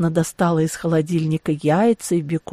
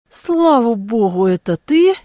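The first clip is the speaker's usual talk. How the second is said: higher-pitched sigh of relief in words; relieved; 3 semitones higher, 4 dB louder than usual. A narrator's reading. Woman recognising Dietrich after a fright, relieved. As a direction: let the relief lift the voice. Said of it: "Облегчение накрыло меня горной лавиной".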